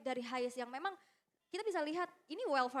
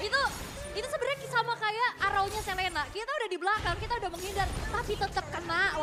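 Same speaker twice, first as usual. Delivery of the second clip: excited caster; 6 semitones higher, 6 dB louder than usual. Real, not acted. Caster fired up over the hit.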